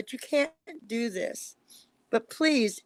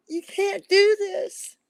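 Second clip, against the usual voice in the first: higher, louder, slow voice on the verge of crying; near tears; 8 semitones higher, 6 dB louder, much slower than usual. Real, not acted. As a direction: cry out "You can't do this" as the tears come. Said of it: "I'm gonna start crying in a minute".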